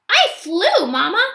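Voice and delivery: put-on high, delighted little-kid voice; a tiny ape giggling after a thrill; little gorilla child. Rescued baby gorilla thanks the elephants, delighted. Kudzu's voice.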